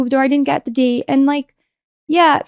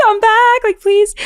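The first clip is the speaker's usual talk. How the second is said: higher, louder playful plea; mock pleading; 11 semitones higher, 4 dB louder, slower than usual. Pleading with the Beis Din on Zoom, higher. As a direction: beg them sweetly to let you in.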